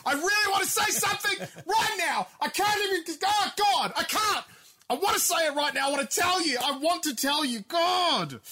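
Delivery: high, loud, strained cry of frustration; host aches to spill the secret news, shouting; bursting to tell. Real, not acted. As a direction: nearly shout that you can't say it. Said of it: "I fear for my life".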